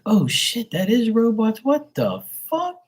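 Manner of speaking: rising to a high squeal of disbelief; shocked realization. Retold or imitated. Watching the game and spotting the robot fans, high shocked squeal. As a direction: voice jumps way up in amazement.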